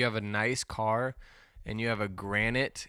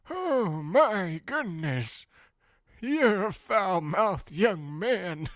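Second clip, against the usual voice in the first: put-on very high, slow old-man outrage; scandalized old man; 9 semitones higher, 4 dB louder, much slower than usual. Old man told to fuck off, high drawn-out scandalized scolding. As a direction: draw it out like an aghast elder. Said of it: "But he would go".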